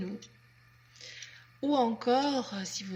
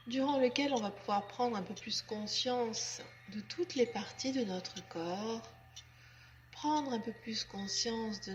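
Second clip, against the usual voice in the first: guided relaxation; 5 dB quieter than usual. Acted. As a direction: guide them gently and unhurriedly into letting go. (voix douce et lente de relaxation guidée)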